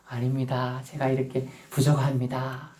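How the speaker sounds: soft, low, self-effacing reply; humble. Acted. Preacher voicing the proud man turned humble, meek and soft. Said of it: "이렇게 겸손해진 거예요".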